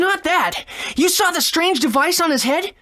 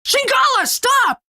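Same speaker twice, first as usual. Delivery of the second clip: shouting a name; 10 semitones higher than usual. Acted. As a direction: yell at him to stop, desperate. Calling to the rampaging dragon.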